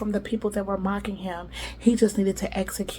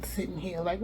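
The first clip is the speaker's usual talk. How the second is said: ordinary talk, nothing marked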